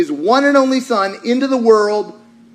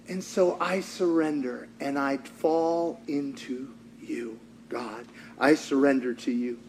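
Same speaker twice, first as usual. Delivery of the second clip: much softer, lower, slowed; quiet surrender; 8 semitones lower, 13 dB quieter than usual. Pretend. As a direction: sink into a soft surrender. Voicing surrender, dropping soft.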